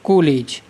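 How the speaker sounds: कॉलेज as "koh-lej"; long "o" replacing the "aw" in कॉलेज; o-vowel mispronunciation. Shown as a demonstration. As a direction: say कॉलेज with a closed "o". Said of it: "क्या लिखना पड़ता हमें".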